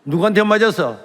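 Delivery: loud, snapping demand; angry teacher. Retold or imitated. Teacher yells at the weeping student, loud and angry. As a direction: bark the question at him.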